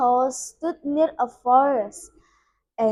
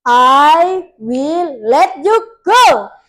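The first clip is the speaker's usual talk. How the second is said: loud, drawn-out pronouncement; witch's slow, loud decree; 8 dB louder, slower than usual. A narrator's reading. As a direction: grant it slowly, with force and weight. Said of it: "the witch said slowly".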